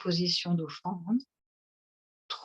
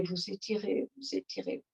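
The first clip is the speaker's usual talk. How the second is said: trailing off softer each time; fading to quiet; 8 dB quieter than usual. Acted. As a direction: let it fade almost to nothing. Repeats stretching cue, fading.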